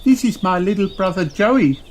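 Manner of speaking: put-on very high child voice; cheeky high kid pointing; little girl Kylie. A narrator's reading. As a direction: Kylie teasing about her brother. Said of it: "says Kylie".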